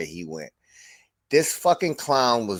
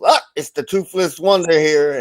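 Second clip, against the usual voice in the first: mocking rival; 6 semitones higher, 7 dB louder than usual. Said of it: "And he goes".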